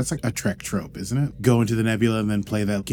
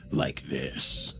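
lower voice; 8 semitones lower than usual. Shown as a demonstration. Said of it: "my voice is normally higher".

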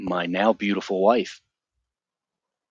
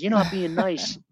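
whiny plea; 11 semitones higher than usual. Retold or imitated. Pleading at locked door.

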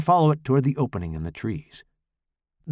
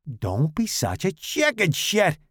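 taunting Tommy voice; 6 semitones higher than usual. Acted.

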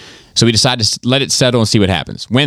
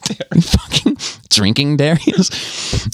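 impressione della donna; 6 semitones higher, slower than usual. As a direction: repeat the accusation as a hammed-up, higher-voiced nag. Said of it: "your impression of the, of this chick".

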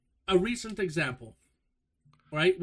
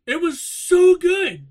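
much higher and louder, slow; gushing; 14 dB louder, much slower than usual. Real, not acted. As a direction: rave about it, drawn out. Gushing about the anime.

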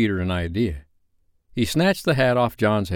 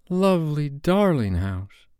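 slow, admiring coo starting high; dreamy, delighted girl; Wendy's voice; 5 semitones higher, slower than usual. A narrator's reading. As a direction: draw the words out in sweet admiration. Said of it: "Wendy said".

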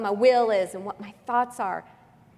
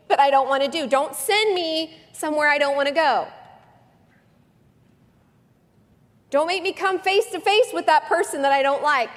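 much higher, louder whiny begging; mock pleading prayer; 7 semitones higher, 5 dB louder than usual. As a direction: whine at God like a spoiled child.

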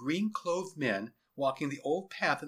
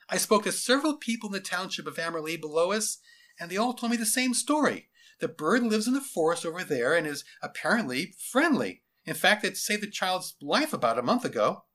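higher-pitched, dutiful report; earnest lieutenant; 6 semitones higher, 4 dB louder than usual. Acted. Lieutenant reporting townspeople's story to his general, earnest. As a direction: report carefully to a superior.

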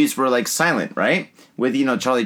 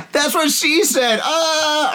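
high, drawn-out joking cry; hammed-up innuendo; 12 semitones higher, much slower than usual. Friend repeating a dirty joke over foamy beer, high and hammed-up. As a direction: stretch it out way up high, mock moaning.

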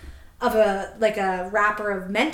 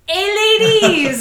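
high, loud, stretched-out holler; long drawn-out imitation of a street shout; mock catcall; 10 semitones higher, 10 dB louder, slower than usual. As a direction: holler across a crowd, imitating a pushy man. Imitates man calling out in a crowd.

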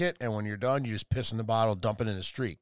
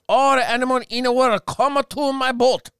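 put-on high, loud caricatured Chinese accent; mock Chinese accent; 9 semitones higher, 6 dB louder than usual. Emperor calling animals to his boat, mock Chinese accent. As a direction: proclaim it in a hammed-up Chinese accent, stretching the end. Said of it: "So the Chinese emperor was like".

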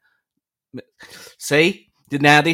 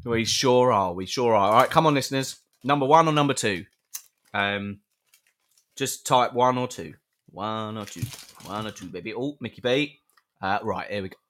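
ordinary talk, nothing marked

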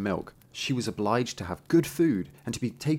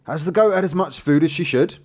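loud, raised questioning voice; demanding old tailor; 7 semitones higher, 7 dB louder than usual. Acted. Old tailor asks his son about the goat, loud demand. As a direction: quiz your son loudly and high.

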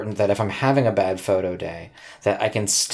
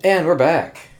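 announcer voice; 6 semitones higher, 4 dB louder than usual. Acted. Returning from fake commercial.

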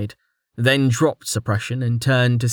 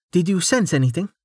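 Amelia, calm; 7 semitones higher, faster than usual. A narrator's reading. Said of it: "Amelia asked calmly".

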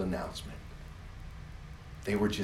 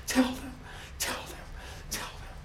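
fading urging; 4 dB quieter than usual. Acted. Repeated, fading each time.

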